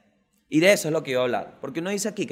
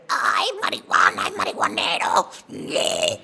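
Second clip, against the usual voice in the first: voz burlona, medio susurrada y más grave; imitando a la gente; 7 semitones lower, slower than usual. Retold or imitated. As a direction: se burla de los que juzgan la marihuana. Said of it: "Porque la gente aquí es". (imitación burlona y susurrada de la gente)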